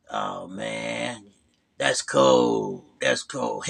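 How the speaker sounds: a little wounded, muttered; lightly voiced Phil. Phil stung by James's insult, low hurt mumble. Phil's reply, retold.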